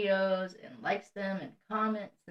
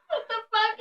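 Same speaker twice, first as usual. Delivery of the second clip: shocked; 3 dB louder than usual. Retold or imitated. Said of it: "I was like".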